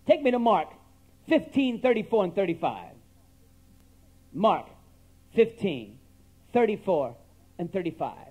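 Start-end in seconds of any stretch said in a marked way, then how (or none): none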